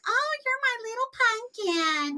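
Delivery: mock doting coo to a little one; cooing pet name. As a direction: coo like a doting parent to a child. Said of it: "we sit here saying".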